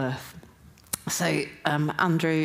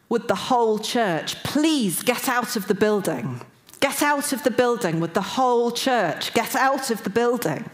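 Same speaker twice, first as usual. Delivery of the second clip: raised, louder, commanding call; urgent authoritative; 6 semitones higher, 3 dB louder than usual. Retold or imitated. Voice in the dream warning the whole church, urgent and authoritative. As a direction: press it home with urgency. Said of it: "it was really urgent".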